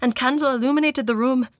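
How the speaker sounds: put-on rough Irish accent, measured reading; amateur Irish accent, narrating; attempted Irish accent. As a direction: carry on the tale in an Irish lilt. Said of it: "This is really rough".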